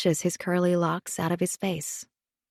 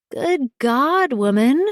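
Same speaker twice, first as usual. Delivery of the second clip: higher-pitched, scandalized disbelief; aghast exclamation; 6 semitones higher, 4 dB louder, slower than usual. A narrator's reading. Friend reacts to hearing how long it's been, aghast. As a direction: blurt it out in friendly horror.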